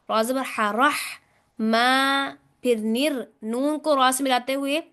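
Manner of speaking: ordinary delivery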